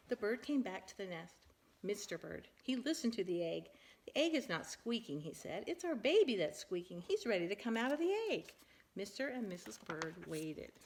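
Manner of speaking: lively, animated picture-book reading; reading to children. Acted. Parent reading a silly bird book to her child, animated. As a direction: read it big and bouncy for a small child.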